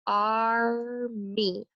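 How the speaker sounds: "Army" drawn out slowly as ar, mee; syllable by syllable. Shown as a demonstration. Teacher labelling syllable scoops, "Army" slowly stretched. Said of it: "I label my scoop".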